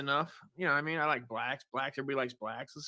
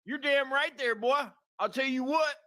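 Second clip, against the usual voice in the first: fake country twang; 7 semitones higher than usual. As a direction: ham up an Ozarks good-old-boy twang, much higher. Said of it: "This is an accent that I'm putting on".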